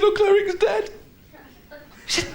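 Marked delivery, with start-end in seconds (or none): 0.0-0.9 lightly voiced little girl. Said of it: "She said".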